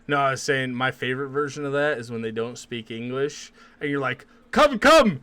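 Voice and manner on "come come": high, loud shout to wave people over; calling out. Retold or imitated. Shouting to players who don't speak English, urgent mock call. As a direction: holler short commands, pitched right up.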